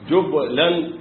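جبلا said jublan, damma on jim, silent ba; جبلا with sukun on ba; jublan reading. Shown as a demonstration. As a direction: say جبلا as jub-lan. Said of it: "بضم الجيم وتسكين الباء".

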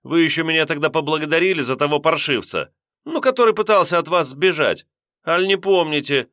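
высоко и громче, горячо доказывая; наперебой убеждать. A narrator's reading. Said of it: "с горячностью объяснять".

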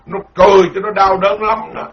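normal talking voice

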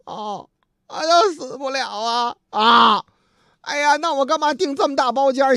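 high comic wail of realisation; mock tearful patient. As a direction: cry out like a man reprieved. 病人得知不会死. (夸张哭腔，恍然哀叹)

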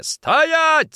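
very high, loud shout; yelling. Acted. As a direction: yell to stop her. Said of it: "закричал Никитин". (крик «Стоять!»)

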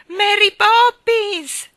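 long, high, loud call; one last drawn-out shout skyward; desperate cry. A narrator's reading. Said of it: "fu l'ultimo grido disperato".

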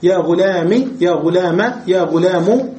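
يا غلام said with i, then a, then u; teacher lists all three endings of غلام clearly; three short endings. Shown as a demonstration. غلام بالكسر والفتح والضم. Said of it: "بالحركات الثلاثة بدون ياء".

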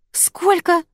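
high-pitched stunned question; shocked disbelief. A narrator's reading. Customer seeing the total on the calculator, shocked. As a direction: shoot the voice up in disbelief.

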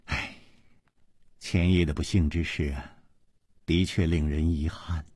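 soft sigh, then quiet regretful words. A narrator's reading. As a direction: sigh and speak softly with regret. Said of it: "秋瓷王妃叹了一口气说".

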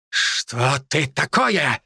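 very slow stunned demon voice; taken aback. A narrator's reading. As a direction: drag it out in shock. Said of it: "опешил нацист".

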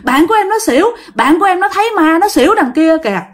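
high, alarmed acted plea; urgent pleading. Girl begging a man to help her fainted friend, pleading.

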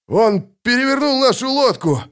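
high, alarmed report; high-pitched excited outburst; breathless exclamation. A narrator's reading. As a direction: blurt out the disaster. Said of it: "выдохнул Локи".

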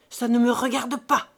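loud, furious refusal; furious repeat. A narrator's reading. Old father refusing his son, loud and furious. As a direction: bark it back at him, loud. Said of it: "répétait furieusement le vieux".

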